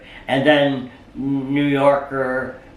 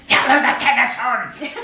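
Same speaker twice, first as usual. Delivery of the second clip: Irish dwarf; 12 semitones higher than usual. Retold or imitated. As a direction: squawk it like a tiny Irish spirit. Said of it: "speaks with this kind of Irish accent".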